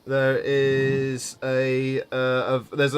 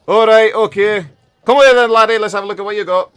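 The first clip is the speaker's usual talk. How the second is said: loud, higher Scottish-tinged dwarf; gruff dwarf; 8 semitones higher, 11 dB louder than usual. Acted. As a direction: beckon the lad over heartily. Dwarf answers, calls laddie.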